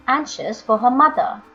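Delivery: plain reading voice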